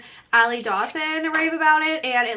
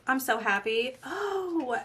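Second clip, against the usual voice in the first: ordinary talk, nothing marked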